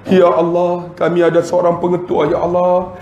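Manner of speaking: chanted, pleading doa delivery; mock prayer. Leading a farewell prayer for the principal, pleading doa voice. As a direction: intone it like a public supplication. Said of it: "saya baca doa".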